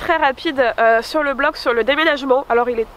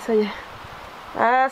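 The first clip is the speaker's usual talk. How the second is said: usual speaking voice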